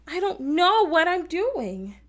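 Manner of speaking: high, loud, slow "know"; hammed-up accent on "know"; stress on KNOW. Shown as a demonstration. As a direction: punch "know" in exasperation. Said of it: "what words you think I'm really accenting".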